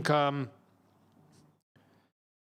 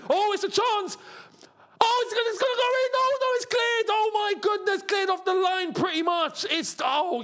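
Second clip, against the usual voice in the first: excited near-goal. Real, not acted. Fan seeing his team almost score, excited high cries. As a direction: ride the chance like it's going in.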